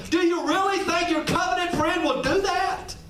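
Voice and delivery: high, rising disbelief on "Do you really"; incredulous question. Acted. Jesus asks the disciples, pitched high and incredulous. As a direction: make it a question nobody would answer yes. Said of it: "Question mark".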